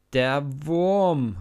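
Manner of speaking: mocking yell at the boy; scornful shout. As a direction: spit it out with contempt. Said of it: "ruft er".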